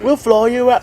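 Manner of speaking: put-on high-pitched, failed Australian accent; mock Australian government voice, pitched high; bad Australian accent. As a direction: attempt Australian and miss badly. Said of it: "That's not an Australian accent".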